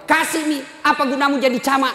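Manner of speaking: wife scolding her camat husband; angry wife. Retold or imitated. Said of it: "Langsung datang mamanya".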